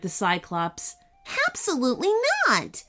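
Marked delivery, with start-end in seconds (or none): 1.5-2.8 sniffy. Acted. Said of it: "sniffed Ananya".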